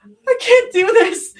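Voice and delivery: high, loud crying; tearful panic. A narrator's reading. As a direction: cry it out helplessly. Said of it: "she cried".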